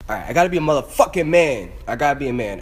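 loud, forceful self pep talk; loud, determined resolve; psyching oneself up. Real, not acted. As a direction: belt it out to find courage.

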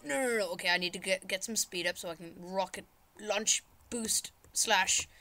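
ordinary delivery

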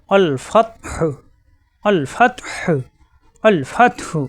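আল ফাতহু with আল and ফাত each said as one closed syllable; joined sakin demo. Shown as a demonstration. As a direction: recite আল ফাতহু, joining the letters tightly. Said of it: "দুই অক্ষর মিলে এক শব্দ হবে". (আল ফাতহু, দুই অক্ষর মিলিয়ে এক শব্দে)

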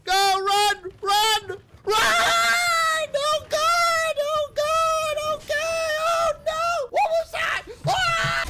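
panicked screaming. Real, not acted. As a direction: shriek in real fright. Screaming during horror game.

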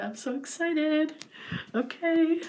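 high, squealing excitement; excited squeal. Real, not acted. Vlogger unboxing her toy, squealing with excitement. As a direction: squeal it out, thrilled.

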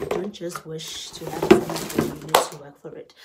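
loud motivational push; firm urging. Real, not acted. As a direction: tell them straight to earn that summer body. Urging viewers to get fit.

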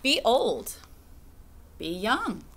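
usual speaking voice

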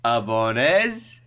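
very slow, drawn-out language lesson; say-it-with-me teaching. Shown as a demonstration. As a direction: stretch each word for viewers to repeat. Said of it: "say it with me".

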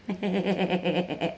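soft chuckling run of laughter. Real, not acted. Mocking Sáu's story, soft chuckling laugh. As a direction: let a quiet, rippling chuckle run on.

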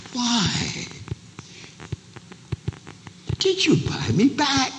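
high, insistent question; pleading question. Acted. Bought-back wife asking her husband why, high plea.

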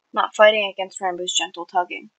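usual speaking voice

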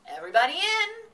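high, loud call to all. A narrator's reading. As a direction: call everyone to the truck. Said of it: "called Mister Arable".

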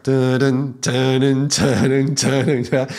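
hummed ominous shark-movie two-note beat; dun-dun like the shark is coming; shark theme hum. Retold or imitated. Teacher jokingly humming the shark movie theme. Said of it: "this movie about the shark".

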